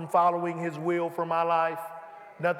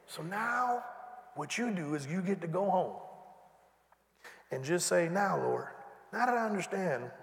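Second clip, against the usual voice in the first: hushed prayerful; 2 semitones lower, 9 dB quieter than usual. Acted. Preacher modelling a prayer for the congregation, hushed. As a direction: pray it gently.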